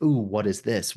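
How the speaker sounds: usual speaking voice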